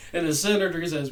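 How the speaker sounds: ordinary talk, nothing marked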